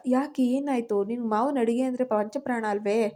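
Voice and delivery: usual speaking voice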